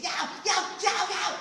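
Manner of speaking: high, loud yow yow; mock panicked shout; alarmed yelp. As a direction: stop him grabbing the sugar. Hermit stops him taking sugar.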